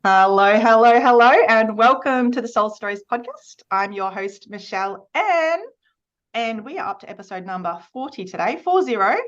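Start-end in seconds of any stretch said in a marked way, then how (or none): 0.0-3.6 loud, bright hello called out; big welcome. Real, not acted. Host loudly greeting her podcast listeners, excited.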